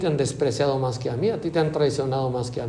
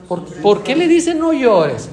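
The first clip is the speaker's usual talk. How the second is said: high, loud and slow, asking in disbelief; incredulous outcry; 7 semitones higher, 7 dB louder than usual. Acted. Preacher objecting to Jesus, loud disbelief. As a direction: press the question, baffled.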